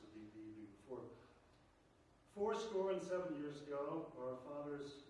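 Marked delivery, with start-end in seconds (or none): 2.3-5.1 slow, loud, ringing opening of an oration; a lecturer breaking into a slow, loud recitation of the speech; formal speech. Acted. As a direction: declaim it like a president at a podium. Starts reciting the Gettysburg Address.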